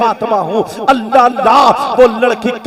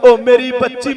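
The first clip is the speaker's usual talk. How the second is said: ordinary talk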